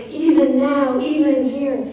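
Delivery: "even now, even here" with each syllable hit hard and loud; every syllable stressed. Shown as a demonstration. Teacher reading the poem line aloud with heavy stresses. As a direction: lean on "even" and "now" and "here", full volume. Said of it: "More stresses compared to unstressed".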